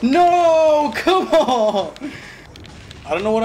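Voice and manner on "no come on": high, loud, drawn-out protest; exasperated wail. Real, not acted. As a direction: wail at another miss. Gamer misses again in game.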